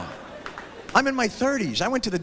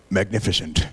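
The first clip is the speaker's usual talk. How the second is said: much lower, admiring; awed doctor; 12 semitones lower than usual. Retold or imitated. Doctor admiring the view, low and awed. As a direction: breathe it like a connoisseur. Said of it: "look up there and go".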